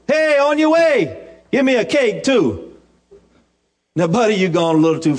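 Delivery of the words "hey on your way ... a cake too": cheeky demand. Retold or imitated. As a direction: stretch out the cheeky ask. Elijah's cheeky extra request.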